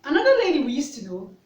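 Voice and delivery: normal talking voice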